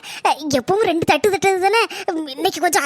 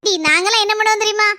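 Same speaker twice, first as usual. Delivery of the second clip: ordinary talk, nothing marked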